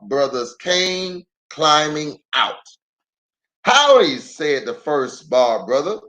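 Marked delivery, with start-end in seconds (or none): 3.6-4.2 high, louder drawn-out howdy; folksy Lem says howdy; hillbilly greeting. Acted. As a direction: greet them like a country boy.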